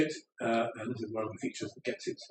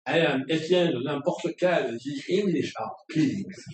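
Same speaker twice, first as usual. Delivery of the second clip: a louder, hammed-up Norman French accent; 4 semitones higher, 4 dB louder than usual. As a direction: play a haughty comic Norman with a French accent. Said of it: "some Norman chap says".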